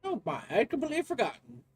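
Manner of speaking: high, affected professor voice; flustered professor. Acted. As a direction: explain in a fussy, lilting professor voice.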